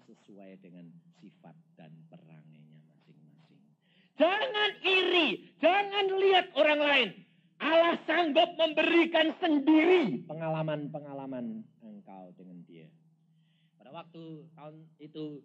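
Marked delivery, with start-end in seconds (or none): 4.2-11.7 slow, high and loud warning; full-force preaching; preaching exhortation. Acted. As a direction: urge the congregation firmly.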